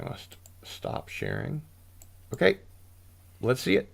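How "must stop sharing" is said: whispered aside. Real, not acted. Muttering to himself after mistake.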